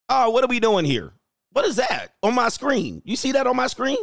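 high, startled questions at the screen; baffled, pitch jumping up; surprised. Real, not acted. Streamer to chat, startled by a pop-up, high surprised voice.

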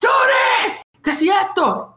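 high-pitched mock scream for someone; imitated yelling. Imitating spooked friend calling Yuri for help, high-pitched yelling. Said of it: "empieza a gritar".

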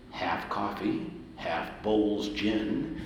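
very slow, drawn-out retold recipe; deliberate recitation. Ray telling the young music director his drink, slow and deliberate. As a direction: list each ingredient slowly, imitating Ray.

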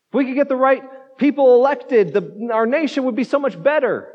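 playing the frustrated believer venting at God; exasperated complaint to God. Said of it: "cry out to God saying".

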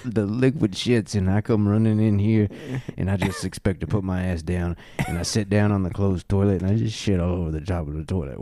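usual speaking voice

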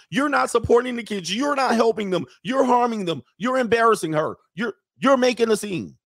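high, whiny mock voice of the mother; mocking imitation of the mother. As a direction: ape her in a high, accusing whine. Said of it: "That is a".

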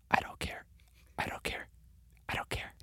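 repeated breathy whisper into the mic; giggly prank whisper. Pretend. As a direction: whisper it teasingly, close to the mic. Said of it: "I'm just gonna whisper into the mic".

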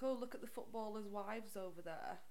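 ordinary talk

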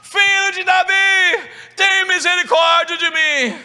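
high, loud, slow cry for mercy; desperate shout. Acted. Bartimeu crying out to Jesus over the crowd, loud. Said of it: "Ele começou a gritar".

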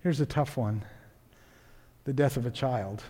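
very soft and low, with long pauses; grave, subdued. Real, not acted. Preacher telling of a friend's loss, grave and hushed. Turns to death of a child.

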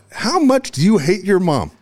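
slow, high-pitched outraged question; retold yell. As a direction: mimic an exasperated coach yelling. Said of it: "he yells at me".

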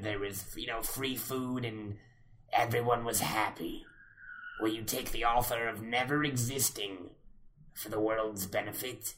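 low, soft devilish storyteller; Mephisto. Acted. Devil offering Jeffy a deal, low tempter's voice. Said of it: "I'll do it in Mephisto voice".

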